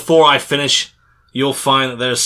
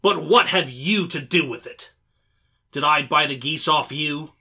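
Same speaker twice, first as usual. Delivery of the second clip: much higher, furious trader shouting; fierce anger; 7 semitones higher than usual. Acted. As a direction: threaten him with the dog. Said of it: "shaking his fist fiercely at the cringing figure".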